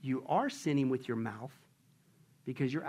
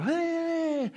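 mock sign calling out; 12 semitones higher than usual. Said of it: "and they're going".

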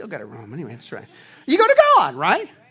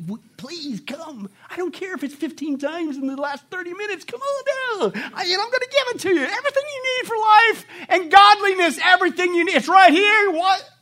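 higher, pleading invitation, rising to louder; God pleading; 8 semitones higher, 5 dB louder than usual. Retold or imitated. Preacher voicing God begging his people to come. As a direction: beg them warmly to come on down. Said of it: "he's almost begging".

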